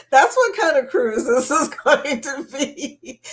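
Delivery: talking through laughter; can't stop laughing mid-sentence; giggling while describing the cruise. Real, not acted.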